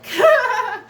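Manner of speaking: a high, loud burst of laughter. Real, not acted. Laughing at the exes who stayed away from the wedding.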